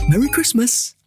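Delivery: ordinary delivery, nothing marked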